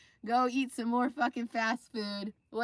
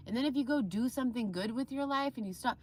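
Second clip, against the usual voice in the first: ordinary talk, nothing marked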